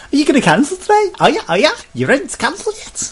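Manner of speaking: squeaky high, loud sales pitch; cheery pushy salesperson. Retold or imitated. Sky agent pushing cancellation, high cheery salesperson voice. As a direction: ham up an eager agent rushing him through.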